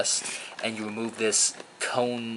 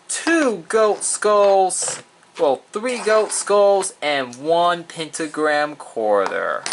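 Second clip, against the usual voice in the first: high, loud, drawn-out rating announcement; announcer voice; 7 semitones higher, 6 dB louder, slower than usual. Acted. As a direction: announce the score like a showman. Host announces the loot-can rating.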